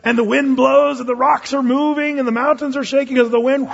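excited storytelling. Acted. Preacher telling of wind shaking the mountains, excited. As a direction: drive the storm along with high energy. Acting out the windstorm.

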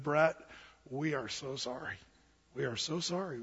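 low, hushed apology; retold sheepish apology. Couple's sheepish apology, acted out.

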